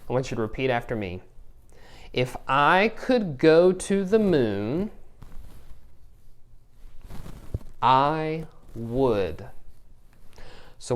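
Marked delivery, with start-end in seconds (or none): 2.1-9.5 slow read aloud. Shown as a demonstration. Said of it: "I'm gonna read your writing prompt to you".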